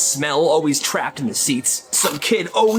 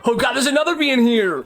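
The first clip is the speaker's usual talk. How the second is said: high, mounting panic; acted high-pitched alarm; panicking kid; 7 semitones higher, faster than usual. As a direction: raise the pitch as a kid's panic grows. Kid finds another bee.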